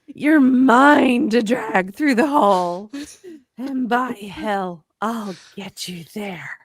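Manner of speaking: slow, forceful vow easing off at the end; harsh biker growl. Acted. Yamaha vowing to save Frank, harsh growl. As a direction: draw it out like an iron-hard promise. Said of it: "harsh as iron".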